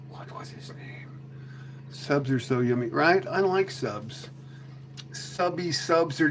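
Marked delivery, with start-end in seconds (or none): 0.1-1.1 barely audible whisper; whispered self-talk. Real, not acted. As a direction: whisper to yourself, searching your memory. Muttering to self, trying to remember.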